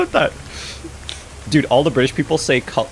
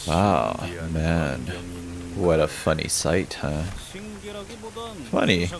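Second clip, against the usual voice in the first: low, soft, unhurried character voice; low game character; 7 semitones lower, 8 dB quieter, slower than usual. Acted. Character remarking on a funny sight, low musing voice. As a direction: speak low and slowly, musing.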